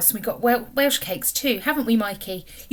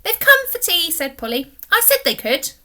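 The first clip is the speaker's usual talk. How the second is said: higher, louder excited introduction; proudly presenting her captain; excited girl; 7 semitones higher, 6 dB louder than usual. A narrator's reading. Polly announces guests.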